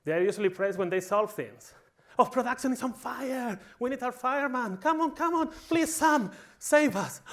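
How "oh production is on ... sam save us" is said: high-pitched mock panic; frantic, pleading cry for help, hammed up; panicked plea. Panicked staff begging Sam to fix production, mock desperate plea.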